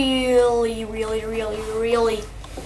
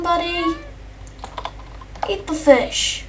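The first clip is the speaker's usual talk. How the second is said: higher, pleading call to the cat; urgent coaxing; 5 semitones higher than usual. Real, not acted. Player urging his cat away from the shark, anxious coaxing. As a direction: coax the pet out of danger.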